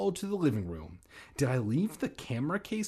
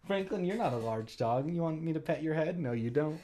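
soft pet-talk voice; 3 semitones higher, 4 dB quieter, faster than usual. Real, not acted. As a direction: coo at the dog. Aside talking to pet.

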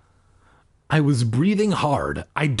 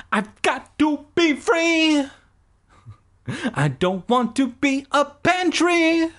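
creature in the cabinet; 13 semitones higher than usual. Acted.

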